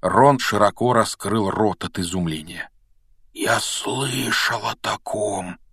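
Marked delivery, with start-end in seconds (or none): 3.3-5.6 slow, choked, awestruck words; strangled voice. A narrator's reading. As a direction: squeeze it out slowly, stunned. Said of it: "произнёс он сдавленным голосом".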